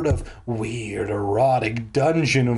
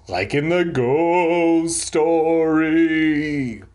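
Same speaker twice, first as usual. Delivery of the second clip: slow, drawn-out ghostly intonation; spooky drawl; much slower than usual. Acted. Narrator linking the shirt to the ghost story, spooky. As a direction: stretch it out like a campfire scare.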